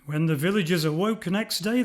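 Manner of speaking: usual speaking voice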